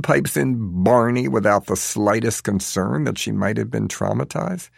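ordinary delivery, nothing marked

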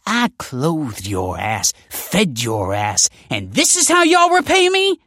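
slow, then high loud fury; fume, building to a shout; fuming. Acted. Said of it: "fumed Stacy".

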